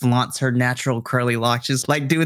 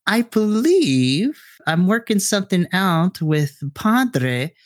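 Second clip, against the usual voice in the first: lightly voiced Missus Garcia; 7 semitones higher than usual. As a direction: Missus Garcia invites the kids over, slightly higher.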